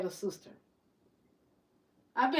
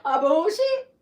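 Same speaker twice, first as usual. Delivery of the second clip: a laughing, louder drawn-out retort; laughing repeat; 4 semitones higher, 8 dB louder, slower than usual. Retold or imitated. She repeats her retort, laughing. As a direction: laugh it out again.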